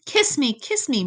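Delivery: high, yearning little echo; murmured plea. A narrator's reading. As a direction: repeat it as a high-pitched murmur. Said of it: "murmured Echo".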